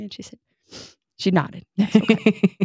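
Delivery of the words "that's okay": ordinary delivery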